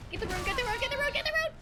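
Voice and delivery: high, rising urgent chant; frantic rapid-fire plea; urgent urging. Real, not acted. Urgent scramble for a rune.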